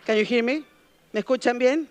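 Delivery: ordinary delivery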